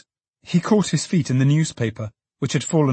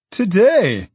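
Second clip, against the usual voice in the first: high and loud Today; young man crying out in delight; surprised cry; 8 semitones higher, 6 dB louder than usual. A narrator's reading. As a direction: toss the word up in happy surprise. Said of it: "cried Richard".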